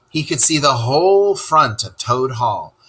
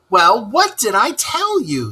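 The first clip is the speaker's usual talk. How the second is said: high, loud scolding; cross; 10 semitones higher, 3 dB louder than usual. Acted. Rat crossly scolds Toad for losing his boat. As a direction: snap the told-you-so crossly. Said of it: "said the rat very crossly".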